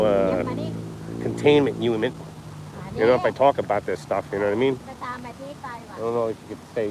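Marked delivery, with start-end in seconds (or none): 5.0-6.9 soft, slow, secretive aside; conspiratorial hush; mock hushed. Hushed about saying taboo word.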